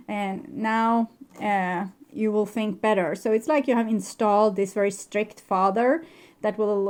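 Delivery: ordinary delivery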